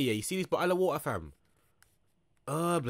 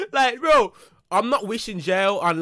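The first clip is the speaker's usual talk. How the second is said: much higher and louder, giddy; excited outburst; 5 semitones higher, 6 dB louder, slower than usual. Real, not acted. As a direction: burst out after the laugh. Laughing at jail body remark.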